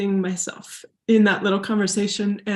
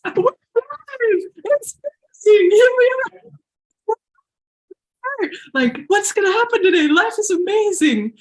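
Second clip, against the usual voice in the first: ecstatic morning cheer; 11 semitones higher, 5 dB louder than usual. Retold or imitated. Waking before her boyfriend's alarm, calling out in much higher ecstatic cheer. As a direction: sing it out like the happiest morning ever. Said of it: "and I'd be like".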